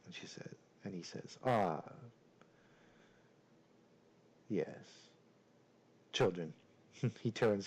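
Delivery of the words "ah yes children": lightly voiced suave lord, long pauses; a hint of a courtly host, unhurried; deep, suave voice. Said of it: "in a very deep and suave voice".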